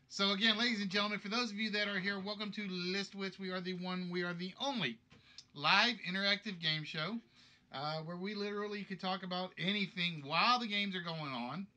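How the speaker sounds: bright host patter, like opening a show; a game show announcer welcoming everyone to Listwits. Acted. Hosts the live game show.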